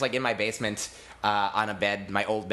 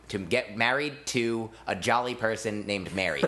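ordinary talk, nothing marked

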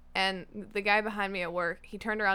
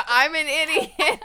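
mock scream; 5 semitones higher, 6 dB louder than usual. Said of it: "if you ever scream".

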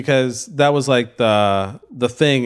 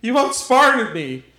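high, slow melodramatic admission; hammy villain confession; 8 semitones higher, slower than usual. Acted. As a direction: play the unmasked villain.

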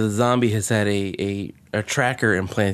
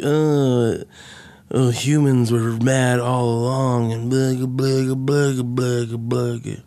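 droning, self-indulgent mock speech dissolving into babble; mocking pompous professor; slower than usual. Host mimicking the philosophy professor's speech, mocking drone. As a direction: drone on like a windbag, then blur into gibberish.

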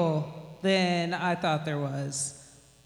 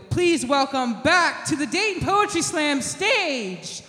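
slow, stretched, much louder shout of the name; grand entrance announcement; 8 semitones higher, 6 dB louder, slower than usual. Real, not acted. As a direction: belt the name out to the whole room. Host calls the poet on stage.

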